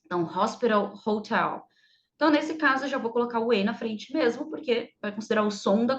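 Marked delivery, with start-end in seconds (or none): 0.2-1.6 "hospital", "hotel" each opening on a breathy h; breathy h sounded. Shown as a demonstration. Teacher showing the sounded h, careful. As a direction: push air on the h of "hospital" and "hotel". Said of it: "como se fosse um som de R".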